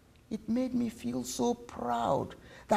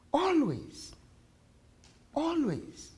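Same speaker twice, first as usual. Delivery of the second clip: soft, higher, falling reassurance; tender promise; 6 semitones higher, 9 dB quieter, slower than usual. Acted. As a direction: promise it softly, word by word. Mother promises child.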